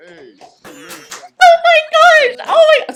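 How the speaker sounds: high, loud squeal easing into breathless delight; excited shriek. Real, not acted. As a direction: squeal with real excitement, then settle slightly. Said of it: "I'm so excited".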